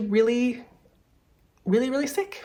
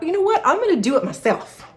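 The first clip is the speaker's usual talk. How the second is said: sudden high bright resolve; excited inner decision; 9 semitones higher than usual. Retold or imitated. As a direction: leap up in pitch, decided. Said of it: "I thought".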